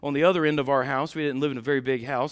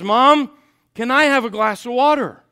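put-on loud, higher kid's request; calling out to mom; 7 semitones higher, 10 dB louder than usual. Brother to mom at the bedroom door, calling out. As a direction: call out for a drink from the doorway.

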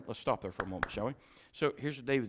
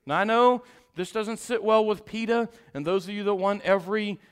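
loud, high, driving sermon voice; full-force preaching; 9 semitones higher, 16 dB louder than usual. Acted. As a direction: hammer each line at the congregation.

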